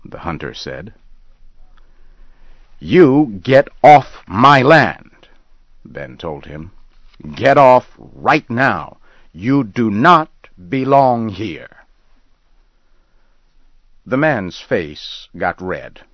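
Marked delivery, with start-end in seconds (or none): 2.8-5.3 much higher and louder, slow and heavy; furious order. Acted. Ben shouts at the hunter. 7.4-11.8 furious order. Acted. Ben shouts at the hunter.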